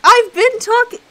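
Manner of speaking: loud, high-pitched outburst; exasperated protest. Real, not acted. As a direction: burst out in indignant self-defence. Protesting after "Then say something!.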